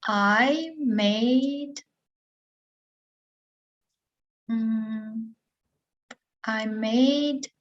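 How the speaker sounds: drawn-out words with long thinking pauses; hesitant student. Pretend. Student searches for what she made yesterday, hesitant.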